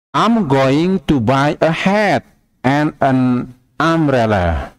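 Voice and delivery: slow, careful English reading; slow model sentence. Shown as a demonstration. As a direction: say it slowly for the class. (kalimat contoh dibaca pelan)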